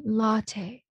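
slow "latte" stressed LA-tay; stress at the front. Shown as a demonstration. Correcting the co-host's stress, slow LA-tay "latte". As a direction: stretch and lean on the LA of "latte". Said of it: "前面latte".

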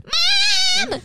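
very high imitated child's wail; calling for mom. Sibling appealing to mother mid-fight, mock high-pitched call. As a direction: screech for a parent like a tattling kid.

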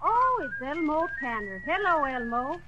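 normal talking voice

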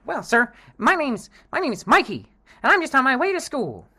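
put-on high, eager gee-whiz boy; wholesome 1950s kid. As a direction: innocent golly-gee schoolboy voice. Kid answers dealer.

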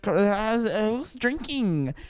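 high, showy comedian working the crowd; stand-up emcee voice. Pretend. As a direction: play to an imaginary club audience. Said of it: "I'm holding a hairbrush, and I'm like".

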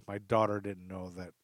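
ordinary talk

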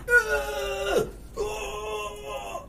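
high shouted mock cries of effort; hammed-up action-movie shouts; actor's strained cries. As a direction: imitate a stunt actor yelling in a mask. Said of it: "him going".

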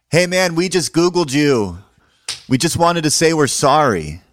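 high, loud retold apology; calling out apology. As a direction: imitate a guy hollering sorry as he approaches. Returning guy calls out an apology.

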